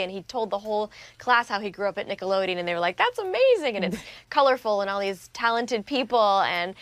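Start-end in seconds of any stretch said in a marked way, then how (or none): 3.0-3.7 mock amazed kids. Classmates quoted reacting.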